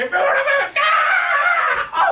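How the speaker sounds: sudden high yell of pain. Retold or imitated. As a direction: cry out as the trap snaps shut. Running jump lands in bear trap.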